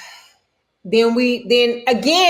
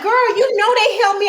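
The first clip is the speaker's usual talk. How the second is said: annoyed; 10 semitones higher than usual. Retold or imitated. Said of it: "'Cause I'm like".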